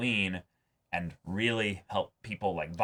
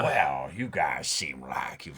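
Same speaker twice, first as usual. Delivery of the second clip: lower, slow menacing bounty hunter voice; Cad Bane; 7 semitones lower, 3 dB quieter, slower than usual. Retold or imitated. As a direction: drop low and slow like a cold hired gun. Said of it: "if Cad Bane then comes in as".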